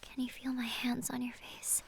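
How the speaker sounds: soft breathless whisper; tender whisper. Acted. Lily whispering to blind Simon. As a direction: whisper close to his face.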